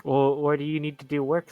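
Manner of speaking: ordinary delivery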